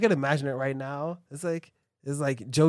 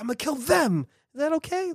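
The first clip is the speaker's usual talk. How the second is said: much higher, sweetly asking; high polite voice; 11 semitones higher than usual. Retold or imitated. Acted quote, very high.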